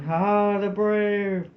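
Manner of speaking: high, loud battle roar; roaring war cry. A narrator's reading. Tam and Doogie charge the vermin, roaring war cry. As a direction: roar it as you charge the foe.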